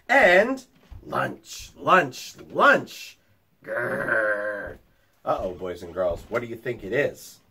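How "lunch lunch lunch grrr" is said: low growling wolf; lightly voiced wolf. A narrator's reading. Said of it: "he heard".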